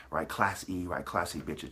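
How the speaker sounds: normal talking voice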